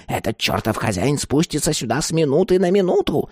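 high, irritated crone; angry, hurried warning; growling old woman. A narrator's reading. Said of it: "прорычала старуха".

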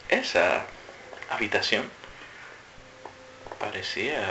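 soft, low, word-by-word suspense; pausas dramáticas. Shown as a demonstration. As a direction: leave a long pause after each word, hushed. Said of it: "una frase cada uno en un tono diferente". (en voz baja, grave, palabra por palabra)

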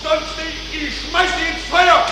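high enraged shouting; furious yelling. Acted. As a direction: threaten in blind rage. Furious threats. (wütend brüllend)

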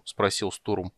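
ordinary delivery

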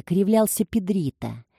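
plain reading voice

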